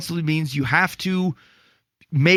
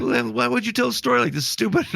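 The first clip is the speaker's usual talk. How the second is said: higher, fast and scoffing; exasperated scorn; 8 semitones higher than usual. Real, not acted. To his cohost about the story, fast exasperated scorn. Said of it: "this story made me freaking pissed".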